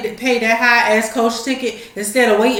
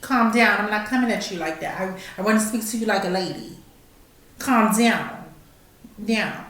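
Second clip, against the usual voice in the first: softer, gentle calming voice; soothing calm-down; 8 dB quieter than usual. Retold or imitated. Attendant softly calming an unruly passenger. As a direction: talk her down softly like a lady. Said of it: "she should have said".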